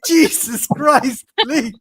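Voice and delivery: loud, slow exasperation at the nagging; exasperated Vinny. Retold or imitated. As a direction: drag it out like a fed-up boyfriend. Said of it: "And he's like".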